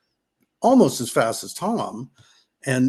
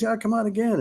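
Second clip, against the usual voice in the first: breezy invite, pitched higher; lightly higher, easy encouragement; casual invite; 9 semitones higher than usual. Retold or imitated. Tom inviting his friend back next week, breezy higher invite. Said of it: "Tom goes".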